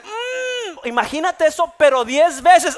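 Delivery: very high, loud hammed-up wail; pained cry. Pastor relives the worst pain to the crowd, a loud high wail. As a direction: cry out as if it hurts tenfold.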